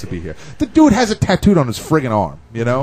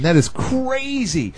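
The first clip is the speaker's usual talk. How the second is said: stunned, drawn out; 12 semitones higher, much slower than usual. Real, not acted. Stunned by reversed clip.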